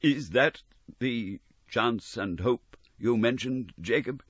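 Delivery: Scrooge's faltering question. Acted. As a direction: ask with quavering dread. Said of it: "in a faltering voice".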